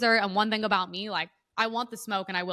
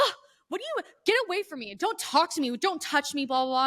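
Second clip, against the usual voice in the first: high, shrill mock panic from other women; hammed-up high mocking whine; mocking scared woman; 6 semitones higher than usual. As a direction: squeal it like a frightened prissy woman. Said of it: "And they're always like".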